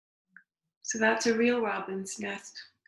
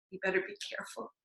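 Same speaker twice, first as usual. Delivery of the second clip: quick whisper; 3 semitones higher, 9 dB quieter, much faster than usual. Real, not acted. Aside warning about the climb.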